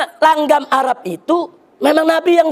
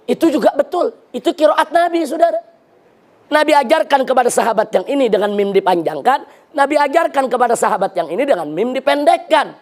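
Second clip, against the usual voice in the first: normal talking voice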